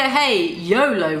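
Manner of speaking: usual speaking voice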